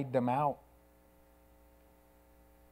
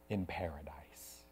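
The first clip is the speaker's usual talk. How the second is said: whispered; 13 semitones lower, 12 dB quieter than usual. Acted. Jesus' promise to the thief.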